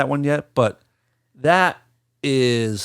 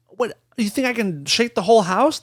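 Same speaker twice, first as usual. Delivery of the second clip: higher incredulous comeback; sarcastic retort; 9 semitones higher than usual. Retold or imitated. Answering girlfriend who thinks he's joking, higher incredulous scoff. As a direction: scoff at her like she's crazy.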